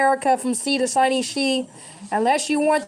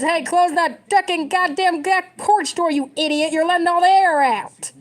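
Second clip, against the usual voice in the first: higher, louder mock yell at the kids; imagined yelling; 6 semitones higher, 3 dB louder than usual. Grandparent yelling at kids to shut the porch door, hammed-up yell. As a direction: bark it like a fed-up grandparent. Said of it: "I wanna be yelling at my great kids".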